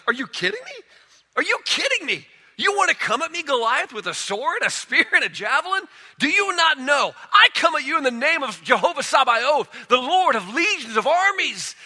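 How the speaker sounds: high, loud, incredulous taunt at the giant; defiant David. Acted. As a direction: throw it at Goliath like a challenge. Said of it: "He's like".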